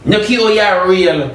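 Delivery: ordinary talk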